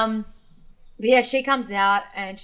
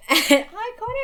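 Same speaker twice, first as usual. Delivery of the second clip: slow, high, laughing echo; 12 semitones higher, 4 dB louder, slower than usual. Real, not acted. Host repeating the Iconics jab, laughing slowly. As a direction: laugh the word out, delighted.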